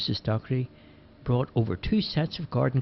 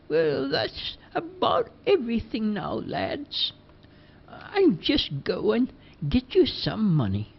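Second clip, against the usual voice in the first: put-on high, slow old lady voice; sweet and unhurried, pitched up; Missus Dockery; 11 semitones higher, slower than usual. Missus Dockery chatting to the lads, high slow old lady voice.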